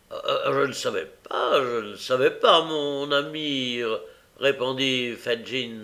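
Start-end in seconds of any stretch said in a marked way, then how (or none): 0.1-3.8 timid pleading reply; humble voice. A narrator's reading. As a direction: stammer it humbly, slowing down. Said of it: "d'une voix humble".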